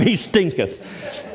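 slow "He stinketh" with a laugh in it; laughing slow repeat. Shown as a demonstration. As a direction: savor "stinketh" slowly, chuckling. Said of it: "I'm gonna say it one more time".

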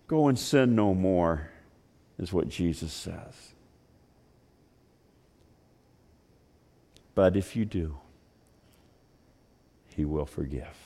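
quiet tender preaching; hushed preaching. Acted. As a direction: bring it down to a near whisper. Hushed sermon close.

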